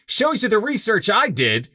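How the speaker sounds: high, excited exclamations breaking into laughter; delighted surprise. Real, not acted. Host learns his guest edited the book, delighted and laughing. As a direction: burst out thrilled, voice shooting way up.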